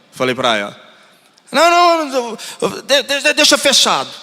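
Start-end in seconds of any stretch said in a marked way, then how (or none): 1.5-4.1 high, stammering mock refusal; agitated old woman. Old woman refusing to unlock the gate, hammed-up fluster. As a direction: stumble over the words in a high voice.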